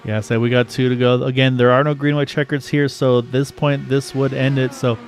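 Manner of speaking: building race call; play-by-play. Acted. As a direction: call the last lap live. Calling the final laps live.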